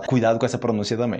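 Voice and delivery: normal talking voice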